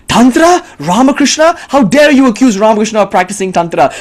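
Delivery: high, loud disbelieving rises; shocked outrage. Retold or imitated. Temple donor scandalised by the retreat topic, high, loud disbelief. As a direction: sound scandalised at the very words.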